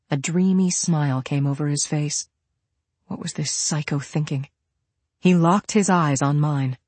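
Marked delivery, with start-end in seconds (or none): none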